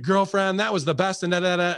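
put-on high, sassy girlfriend gush; camp gushing. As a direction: play up the camp cheer. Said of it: "in this S J W world, we get".

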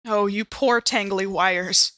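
normal talking voice

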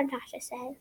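ordinary delivery, nothing marked

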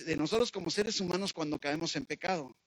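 normal talking voice

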